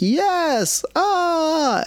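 high mock yell of a fed-up kid; frustrated child. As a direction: squeal it like a cornered seven-year-old. Child's frustrated cry voiced.

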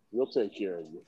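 low, soft bedroom murmur; mock seductive. Host offering to take care of Liz, in a mock-seductive low voice.

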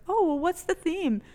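high, bright teacher voice; chirpy high teacher asking. Retold or imitated. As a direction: pitch it way up like a cheery teacher. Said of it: "the teacher's like".

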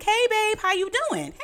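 high, chirpy morning greeting; too much happy. Retold or imitated. Mom calls her oldest child in the morning, too happy. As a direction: greet him with over-bright, high-pitched cheer. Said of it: "that's too much happy at eight o'clock".

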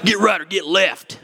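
a hammed-up Southern preacher twang on every word; Southern accent. Mocking street preachers, he says it in a Southern accent. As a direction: put on a mock Southern fire-and-brimstone accent. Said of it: "That has to be said with a Southern accent".

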